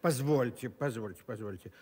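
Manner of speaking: flustered. Acted. Teacher flustered by correction.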